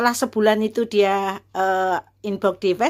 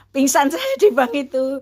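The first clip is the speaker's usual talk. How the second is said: real laugh breaking into the words, pitched up; amused at oneself, laughing mid-phrase; 6 semitones higher, 3 dB louder than usual. Real, not acted. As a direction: tell it through a laugh. Laughs at own fainting.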